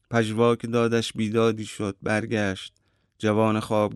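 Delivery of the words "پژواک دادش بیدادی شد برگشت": usual speaking voice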